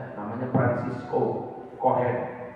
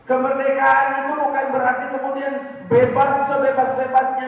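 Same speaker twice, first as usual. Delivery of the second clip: high, loud, driving sermon voice; fiery preaching; 14 semitones higher, 7 dB louder than usual. Acted. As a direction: hammer the point home to the crowd. Preaching at full force. (berkhotbah berapi-api, tinggi dan keras)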